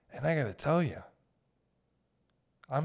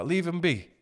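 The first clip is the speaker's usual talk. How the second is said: put-on old-fashioned phrasing; 5 semitones higher, 4 dB louder than usual. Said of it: "it's the proper way to say it, but it's kinda backwards".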